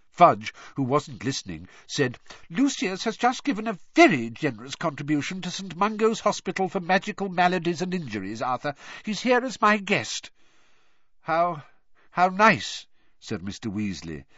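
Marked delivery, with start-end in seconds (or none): 2.5-10.3 higher, brisk, oblivious minister; breezy, pleased with himself; cheerful official. A narrator's reading. Fudge voiced, oblivious and cheery. 11.2-12.9 forced, tight-smiled politeness; strained politeness. A narrator's reading. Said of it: "with a very strained smile".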